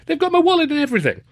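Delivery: high posh student, affronted; young Cambridge gentleman, outraged. A narrator's reading. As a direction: complain like a wronged undergraduate. Narrator voices Luscombe.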